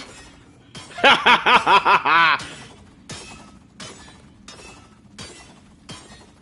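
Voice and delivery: loud, high burst of laughter; helpless high cackling; big laugh. Real, not acted. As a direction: crack up in rapid peals. Cracks up at the loop.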